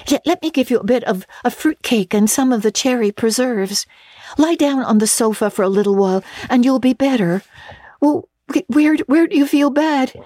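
put-on a breathless young girl confiding; Anne's girlish voice. Girl confiding a mortifying dinner to her friend, eager. Anne fussing over Diana.

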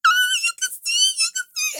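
very high squealed imitation; mimicked excited relative. Family on FaceTime as he proposes, mimicked squealing. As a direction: squeak it out like an overwhelmed onlooker.

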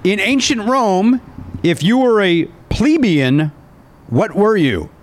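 slow, deliberate quiz-host delivery; slow question read. Shown as a demonstration. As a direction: read the question slowly and clearly. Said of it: "here's the question first".

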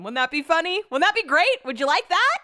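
high, rising mock-sweet questions; mock eager teasing. Teasing listeners about repeating the joke, mock eager. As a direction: needle them in a high voice.

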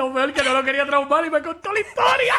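shouting through laughter; laughing. Real, not acted. Laughing while telling the story.

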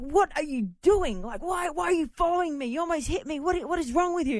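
imitating a riled-up biker; angry bikie. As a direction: play the biker confronting her window. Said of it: "this bikie comes up real angry".

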